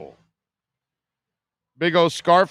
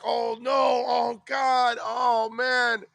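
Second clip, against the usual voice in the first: high, slow, hammed-up wail of dismay; mock dismay; 8 semitones higher, much slower than usual. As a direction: groan like your weekend just died. Reaction on hearing you're abducted.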